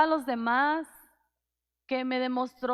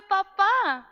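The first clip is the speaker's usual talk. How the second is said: put-on higher, louder baby-like "Papá"; baby's first word; 6 semitones higher, 8 dB louder than usual. Newborn daughter's first word to her father, childlike "Papá". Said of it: "mi primer palabra fue".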